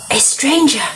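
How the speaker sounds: put-on a touch of shock for young listeners; storybook gasp.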